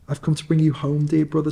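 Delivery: a happy little sister bursting in; lightly voiced little girl. Little Fan greets her brother.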